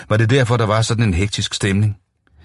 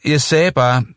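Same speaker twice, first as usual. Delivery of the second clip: gentager råbende; 7 semitones higher, 3 dB louder, slower than usual. Acted. Gentager råbende over musikken.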